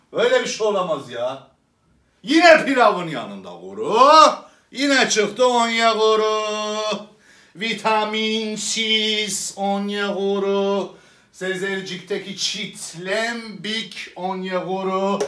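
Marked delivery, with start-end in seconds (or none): 4.7-15.2 slow, mocking stretched name; mocking drawl. Comic mocks the striker with a slow scornful drawl.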